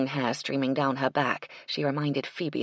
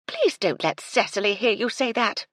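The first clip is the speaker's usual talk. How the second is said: higher, louder sharp rebuke; 7 semitones higher, 5 dB louder than usual. Acted. Phoebe scolding Madeline, sharp louder rebuke. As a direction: cut her off firmly. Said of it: "in a sharp voice".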